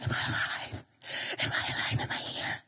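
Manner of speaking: soft whisper after a crash; stunned whisper. Acted. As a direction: whisper it in mock shock. Stunned crash victim checks themselves.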